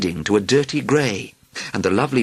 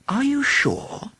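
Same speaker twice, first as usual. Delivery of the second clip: higher, slow curious question; much slower than usual. A narrator's reading. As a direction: ask with mild curiosity. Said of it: "she asked curiously".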